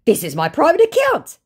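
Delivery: imitating the scammer; mock scammer. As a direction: parody the fake Brad. Fake Brad's reply.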